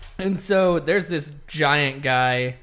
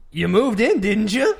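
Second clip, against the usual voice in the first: evasive dodge; 8 semitones higher, 7 dB louder than usual. Retold or imitated. Acting out Hanks dodging question.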